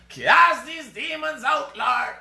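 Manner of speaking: high, drawn-out pleading shout; hammed-up shouted prayer; mock exorcism cry. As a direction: cry out slowly like a comic exorcist. Mock exorcism after the cackle.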